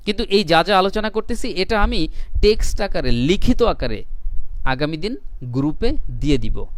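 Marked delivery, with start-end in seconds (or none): none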